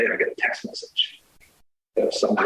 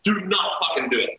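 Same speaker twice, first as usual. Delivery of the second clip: retold screaming; 7 semitones higher than usual. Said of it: "I'm just screaming at her".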